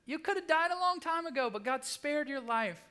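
high-pitched, loud disbelief; incredulous scolding. Acted. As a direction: scold him in exasperated disbelief.

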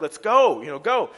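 frustrated mock yell. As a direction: act out an impatient rider, loudly. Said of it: "he's frustrated".

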